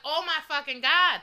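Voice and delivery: loud, drawn-out alarm; slow, emphatic acted-out horror; retold shock. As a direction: reenact a shocked outcry, stretched out. Retold shock at seeing him fall.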